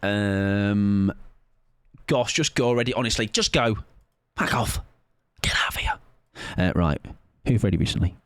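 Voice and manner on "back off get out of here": breathy whispered brush-off; mock secret shoo-away whisper. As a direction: hiss it out under the breath. Mock shooing chat away.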